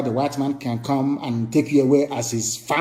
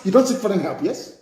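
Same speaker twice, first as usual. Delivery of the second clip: ordinary talk, nothing marked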